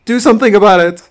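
hammed-up panicked yelp, high and loud; mock panic. To friends, retelling finding water in his watch, mock panic.

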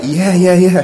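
gushing back at a star; eager yes. Retold or imitated. Eager reply to Tom Hanks.

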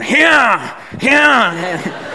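high, drawn-out cow call; hammed-up cow call, pitched high; cow-calling noise. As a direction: call it like you're calling cows. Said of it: "Why are you making that noise?".

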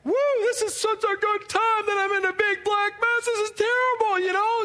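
high, bright mock-happy "woo" that sours into complaint; chirpy put-on joy, dripping with sarcasm; sarcastic fake cheer. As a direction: parody forced happiness, sarcastically. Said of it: "I was supposed to be happy, like".

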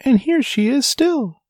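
tiny, very high, delighted squeak; joyful squeaky baby bear. A narrator's reading. Baby bear announcing his discovery of Goldilocks, joyful squeak. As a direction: squeak it gleefully as the littlest bear. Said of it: "squeaked the baby bear joyfully".

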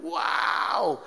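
drawn-out "wow" of someone falling; mock startled cry. Preacher re-enacting his slip on the step, mock startled cry. Acts out own slip and fall.

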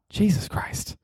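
whispered under the breath; exasperated whisper. Acted. Fed up with people getting the name wrong, whispering. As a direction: hiss it in exasperation.

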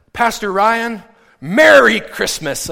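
loud, slow and beaming; joyful greeting. Retold or imitated. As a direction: call out the greeting with delight. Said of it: "And he's all filled with joy".

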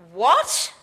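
loud, disbelieving outburst with rising pitch; incredulous response. Retold or imitated. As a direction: blurt it out as an ungrateful, doubting servant. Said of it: "immediate response shows a lack of gratitude".

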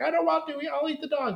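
high mock whine; hammed-up high whining refusal; whiny Louis. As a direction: refuse in a squeaky pout. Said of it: "he's like".

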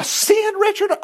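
very high, incredulous question; mock disbelief. Real, not acted. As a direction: feign shock at the word sin. Preacher mock-incredulous to congregant.

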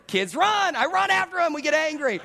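high, loud, excited retelling; hammed-up bedtime chaos, half shouted; mock chase frenzy. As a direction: yell it like the chase is on.

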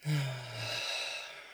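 long exhaled sigh; demonstrated out-breath. Shown as a demonstration. Meditation guide breathing out with listeners lying down, long sigh. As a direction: let a deep breath all the way out. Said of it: "out through the mouth".

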